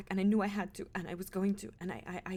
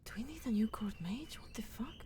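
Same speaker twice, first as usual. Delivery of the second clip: unvoiced whisper, stunned; whispered aside. Players whispering in shock after the mage's outburst. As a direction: hiss it aside to the table.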